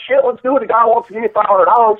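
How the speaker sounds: high-pitched mock eagerness; imitating an eager friend. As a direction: jump at the offer in a high put-on voice. Imitates Timbo eagerly accepting the job.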